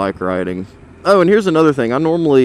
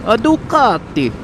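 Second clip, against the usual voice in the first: playful mock Italian accent, pitched up; 4 semitones higher than usual. Rider spotting an Italian bike on the road, mock Italian accent. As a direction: put on a cartoonish Italian voice. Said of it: "Why am I doing an Italian voice?".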